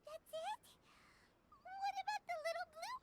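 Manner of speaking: rising, near-tearful questions; upset. Acted. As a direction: plead for the little Oni. Upset at sad ending.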